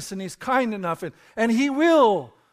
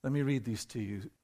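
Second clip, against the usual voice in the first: normal talking voice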